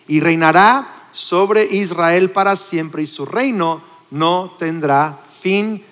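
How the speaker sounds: very slow, drawing out each word to the end; slow emphatic reading. Acted. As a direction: stretch it out as if forever. Preacher reads scripture slowly. (lectura muy lenta y solemne)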